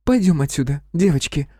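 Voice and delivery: lightly voiced bossy young woman; commanding. A narrator's reading. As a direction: order your girlfriends away. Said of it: "скомандовала".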